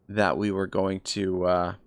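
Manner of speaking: ordinary delivery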